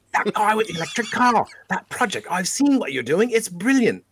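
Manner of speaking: high, lightly put-on British lady accent. Praising the project, a light British accent. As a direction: gush in a sweet British accent. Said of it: "should I do the British accent".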